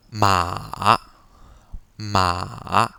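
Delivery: «Ma» au troisième ton: descend puis remonte; troisième ton chinois. Shown as a demonstration. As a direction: dip then rise on ma. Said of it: "vous devez descendre votre ton et après, il faut remonter". (troisième ton, descendant puis montant)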